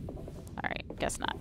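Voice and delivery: ordinary talk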